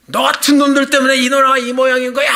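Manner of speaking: loud, high final jab as he storms off; angry parting shout. Retold or imitated. Young man storming off from the clerk, angry shout. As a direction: spit the last word and leave.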